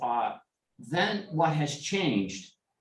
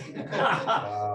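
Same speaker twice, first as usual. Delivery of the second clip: breathy laugh; 8 semitones higher than usual. Real, not acted. Laugh after the quote.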